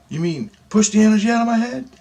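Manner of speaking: high, loud retold disbelief; incredulous question. Checking what she means by sleep, high incredulous voice. His incredulous reply to her yelling.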